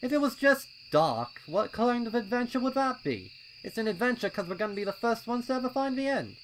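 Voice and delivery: high, loud, impatient colt voice; a keen little colt itching to go; eager young colt. A narrator's reading. Pipsqueak urging his friends at the trail, high and loud.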